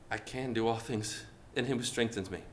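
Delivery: low, soft, unsure reading of the verse; timid, quiet declaration. Shown as a demonstration. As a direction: say it meekly, almost to yourself.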